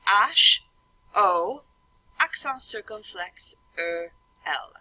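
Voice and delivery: "H" as French "ahsh", then each letter in French; French letter names with pauses between; French spelling aloud. Shown as a demonstration. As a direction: spell "H, O, accent circumflex, E, L" in French. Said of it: "So I'd say".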